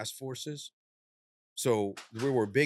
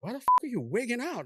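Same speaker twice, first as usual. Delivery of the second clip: partner's alarm; 12 semitones higher, 4 dB louder, faster than usual. Retold or imitated. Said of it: "My partner walks up and he goes".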